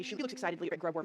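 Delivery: ordinary talk, nothing marked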